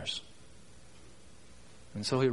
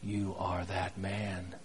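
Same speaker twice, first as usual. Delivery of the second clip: low, hushed, slow indictment; quiet accusations with heavy pauses between them; accusing prophet; 5 semitones lower, 7 dB quieter than usual. Acted. Nathan the prophet confronting David with his sin, quietly accusing. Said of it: "Nathan the prophet said".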